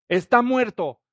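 loud shocked declaration; alarmed crowd. Acted. Bystanders shouting the boy is dead, loud and alarmed. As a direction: announce it in alarm. Witnesses voiced declaring death.

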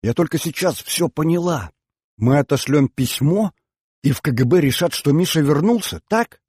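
put-on keyed-up girl seeking confirmation; excited realisation. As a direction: work it out aloud with mounting excitement. Girl excitedly grasps the whole plan.

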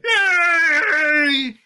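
long drawn-out cheer; cheering yay. Retold or imitated. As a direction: stretch out a happy yay. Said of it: "someone to do, like".

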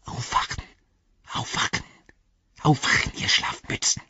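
hissy creature whisper; urgent whisper. A narrator's reading. Gollum wakes the sleeping hobbits, whispering. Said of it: "flüsterte er".

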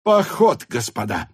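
"Поход" cried high and loud; elated announcement. Acted. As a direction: call out "Поход" high, then drop. Officers exclaim at marching orders. (радостно и громко выкрикнуть: «Поход»)

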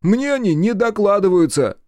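high, loud, put-out partner voice; high-pitched defensive buddy; indignant sidekick. A narrator's reading. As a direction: grumble back at the boss in a high voice.

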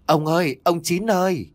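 high, long call into the dark; anxious night call, voice carried far. Acted. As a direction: call out for the husband across the yard. Grandma calling husband in dark.

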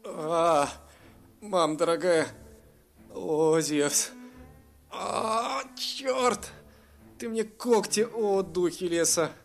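pained cries. Acted. Elf pierced by the dragon's claws, crying out. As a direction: yelp through the hurt.

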